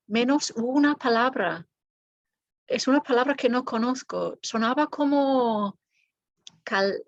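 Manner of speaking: fast, fluent, confident Spanish; fluent self-assured Spanish. Shown as a demonstration. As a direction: rattle it off like a fluent speaker. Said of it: "Contrast that with this".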